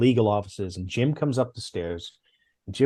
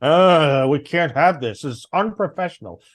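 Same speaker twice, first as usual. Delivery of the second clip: mock scolding boss; 4 semitones higher, 5 dB louder than usual. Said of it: "he was yelling at me over breach".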